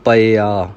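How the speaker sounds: ਪਿਆ as pa-i-aa, bare p then i; open first syllable. Shown as a demonstration. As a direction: sound the i after an open pa in ਪਿਆ. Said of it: "ਈੜੀ ਨੂੰ ਸਿਹਾਰੀ ਲਾਉਣੀ ਹੈ". (ਪਿਆ ਵਿੱਚ ਪੱਪਾ ਮੁਕਤਾ, ਈੜੀ ਨੂੰ ਸਿਹਾਰੀ)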